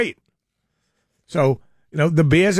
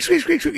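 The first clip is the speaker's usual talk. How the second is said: squeak, squeak, squeak pitched way up like a toy; high squeaky toy squeaks. Retold or imitated. Host imitating the squeaky toy for listeners, pitched high. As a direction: mimic a rubber toy's squeak, high and quick.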